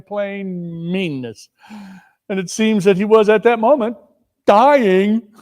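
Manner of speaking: can't hide the relief; relieved husband. Acted. Relieved husband, half laughing.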